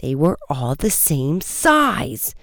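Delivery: slow, stubborn drawl with a swooping last word; insistent repeat. A narrator's reading. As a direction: repeat it doggedly, stretching it out. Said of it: "the teller repeated, growing swollen".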